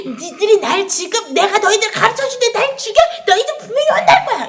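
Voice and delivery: high, loud, hammed-up scolding threat, speeding up at the end; mock angry threat. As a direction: play a sulky martyr threatening revenge, half laughing. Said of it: "이렇게 하고 죽었어요?". (과장되게 화내며 협박하는 목소리)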